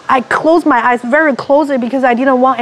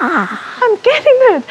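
ecstatic; 7 semitones higher than usual. Retold or imitated. Ecstatic realization in healing.

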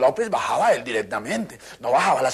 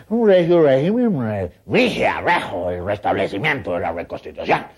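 pompous tongue twister; 3 semitones lower, 3 dB louder, slower than usual. Retold or imitated. Trabalenguas de político.

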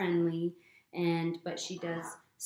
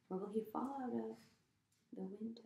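ordinary delivery

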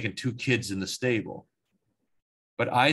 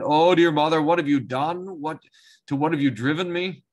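distressed cry; 5 semitones higher, 6 dB louder than usual. Acted. Jacob sweats, blames mother's plan.

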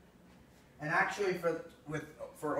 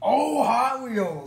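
high, loud and slow; excited drawn out call; 9 semitones higher, 12 dB louder, much slower than usual. Real, not acted. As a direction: stretch out the winner's name. Announcing the race winner.

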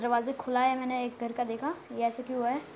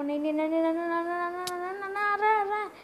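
run-together pleas climbing steadily higher; mounting panic; 5 semitones higher, faster than usual. Real, not acted. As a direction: let the panic wind upward into a wail. Zombie attacking, pleads. (बढ़ती घबराहट में, चढ़ती आवाज़)

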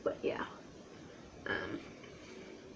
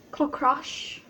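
ordinary talk, nothing marked

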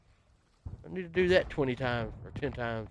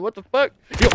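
usual speaking voice

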